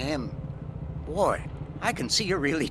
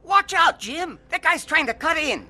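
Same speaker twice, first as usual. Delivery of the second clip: shouted warning; 11 semitones higher, 5 dB louder than usual. Acted. Brother warns of a car.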